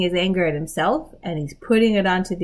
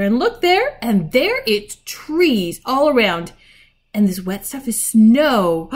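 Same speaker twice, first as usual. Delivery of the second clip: raised voice, building amazement; excited wonder; 5 semitones higher, 7 dB louder than usual. A narrator's reading. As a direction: marvel aloud at the discovery. Peter discovers trees and snow.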